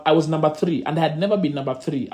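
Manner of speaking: ordinary talk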